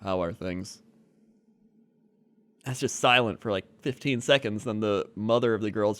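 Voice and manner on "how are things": awkward mumble. Retold or imitated. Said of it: "he's just like".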